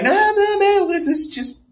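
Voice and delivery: very high, squeaky protest; mock squeal; stoner peacemaker. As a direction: plead in a tiny high voice. Acts out stoned character calming others.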